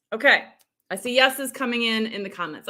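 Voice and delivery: usual speaking voice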